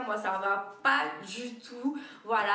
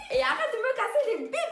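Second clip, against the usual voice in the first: much higher and louder, shouting through a laugh; 13 semitones higher, 6 dB louder than usual. Retold or imitated. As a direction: yell it again, cracking up. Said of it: "J'ai dit". (cri aigu en riant)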